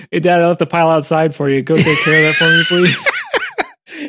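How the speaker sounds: lightly voiced kid shouting up to dad; mock kid yelling please with a laugh; cheeky kid. Kid voiced leaving a mess.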